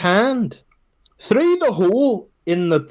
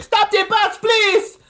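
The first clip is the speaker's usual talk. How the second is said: much higher, imitating a desperate daughter; frantic girl yelling; slower than usual. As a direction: plead like a panicked young woman. Girl begs driver to stop.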